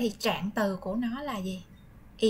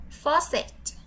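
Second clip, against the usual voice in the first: model word; 5 semitones higher, slower than usual. Shown as a demonstration.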